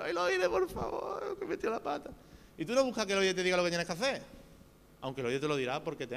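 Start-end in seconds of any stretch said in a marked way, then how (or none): 0.0-2.1 mock crying plea. Believer who messed up, crying to a listening friend, mock tearful wail. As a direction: play a sinner crying into a friend's ear. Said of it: "Tú vas llorando al oído".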